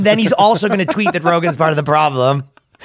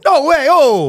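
much higher, louder hammed-up Italian exclamation; mock Italian speech; 11 semitones higher, 4 dB louder, slower than usual. Pope Francis giving his speech, in a hammed-up Italian accent. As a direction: mimic the Pope orating in Italian. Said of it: "he did a speech in Italian".

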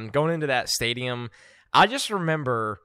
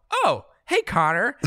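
cheery greeting; 8 semitones higher, 3 dB louder than usual. Retold or imitated. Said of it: "and then you go".

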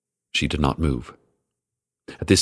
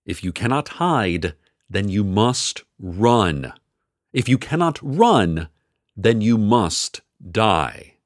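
slow, grave sponsor message; ominous ad read; 6 semitones higher than usual. Acted. Radio host reads a sponsor message in a grave, slow style. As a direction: read the doom like an ad.

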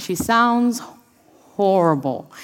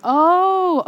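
mock realization; 10 semitones higher, 4 dB louder than usual. Sudden realization, lesson.

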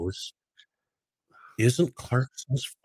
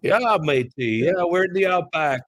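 high, hammed-up Aussie "matey" voice; mock Australian accent, pitched up and louder; 9 semitones higher, 5 dB louder than usual. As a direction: play a cartoon Australian bloke. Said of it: "this is my Australian accent".

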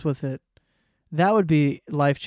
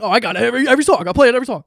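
higher, louder imitation of the radio; mock radio hype; 7 semitones higher, 10 dB louder, much faster than usual. Host mimicking a radio that plays every song, mock hype. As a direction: exaggerate it, high and loud.

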